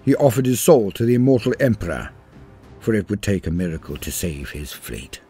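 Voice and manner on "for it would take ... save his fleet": usual speaking voice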